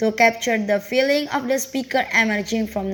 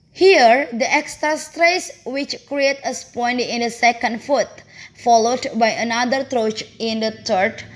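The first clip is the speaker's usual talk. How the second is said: ordinary talk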